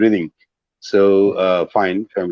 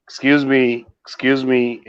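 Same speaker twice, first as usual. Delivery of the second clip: "Excuse me" higher, repeated; raised intonation; 5 semitones higher than usual. Shown as a demonstration. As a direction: EXCUSE ME pitched higher on both words. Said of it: "So you see the intonation".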